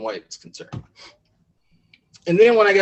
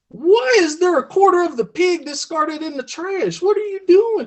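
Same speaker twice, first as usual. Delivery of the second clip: high-pitched, hammed-up outrage; indignant husband; 12 semitones higher than usual. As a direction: demand an explanation as a baffled country husband. Husband confronts wife in story.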